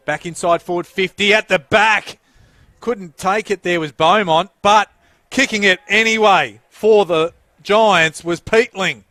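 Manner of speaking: loud, rising call of a scoring chance; excited play-by-play. Real, not acted. Live play-by-play.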